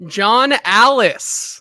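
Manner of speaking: big, loud, drawn-out name call; winner announcement. Real, not acted. Co-host revealing the giveaway winner, loud triumphant announcer call. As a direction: proclaim the name high and slow.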